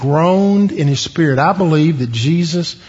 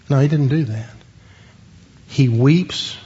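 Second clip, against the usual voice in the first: ordinary talk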